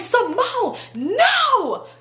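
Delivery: very high, cracking mock wail; hammed-up high-pitched plea; overdone friend impression. Friend begging Simone to stop, overdone high wail. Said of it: "Let me talk right".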